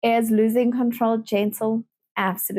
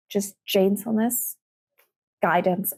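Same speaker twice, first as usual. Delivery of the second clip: ordinary talk, nothing marked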